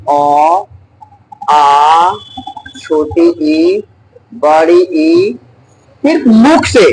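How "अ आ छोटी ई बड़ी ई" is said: vowels read out one by one, slowly; teacher reciting example vowels slowly to the class; slow recitation. Shown as a demonstration. Slow teaching recitation. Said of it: "teacher reciting vowel examples".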